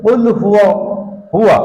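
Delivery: কুল হুয়া with a full, long হুয়া; correct reading. Shown as a demonstration. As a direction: stretch হুয়া out clearly, not হু. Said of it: "কিন্তু হবে".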